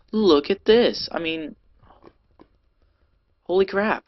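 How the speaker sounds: excited. Real, not acted. Amazed at a lava cave.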